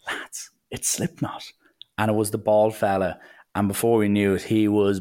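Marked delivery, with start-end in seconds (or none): 0.1-1.5 slow, hushed whisper to mates; whispering to the lads in the taxi. Retold or imitated. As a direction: whisper the discovery so the driver won't hear. Spotting the driver in taxi.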